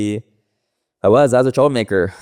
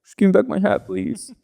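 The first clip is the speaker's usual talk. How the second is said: high, whiny mock-tearful plea, higher; retold tearful plea to the bully, much higher; imitating a crying kid; 12 semitones higher than usual. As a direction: beg for the hat like a kid in tears, half-joking. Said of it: "I started fucking crying".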